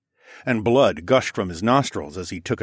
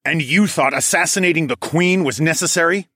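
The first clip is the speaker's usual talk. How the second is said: louder, higher accusing question; raised voice; 9 semitones higher, 6 dB louder than usual. A narrator's reading. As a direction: project it so bystanders overhear. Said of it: "in a voice just loud enough".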